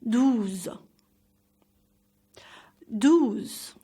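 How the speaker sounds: "Douze" with lips pushed forward on the vowel; rounded French pronunciation. Shown as a demonstration. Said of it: "Push your lips forward when saying".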